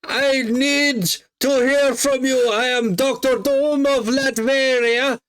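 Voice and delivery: very high, slow, hammed-up villain voice; squeaky, slow mock tyrant addressing his rival; Doctor Doom voice. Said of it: "that was my Doctor Doom".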